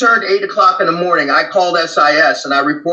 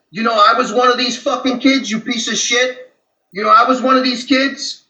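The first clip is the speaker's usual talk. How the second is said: angry retort; 6 semitones higher than usual. Retold or imitated. Angry outburst at coworker.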